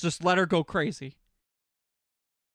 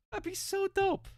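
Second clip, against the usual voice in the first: high, softer squeak of excitement; high giddy squeal; 13 semitones higher, 6 dB quieter than usual. Real, not acted. Streamer dreaming of a VTuber company, high giddy squeak.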